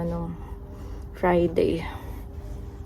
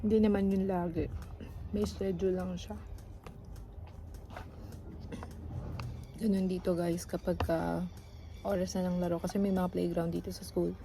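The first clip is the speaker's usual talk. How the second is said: hushed vlogging; 14 dB quieter than usual. Real, not acted. Quiet vlog at school.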